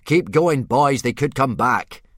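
put-on teacher shouting to boys to run; urgent call. A narrator's reading. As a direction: hurry the boys along. Gobber urging boys to flee.

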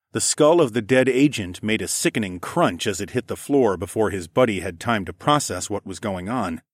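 ordinary delivery, nothing marked

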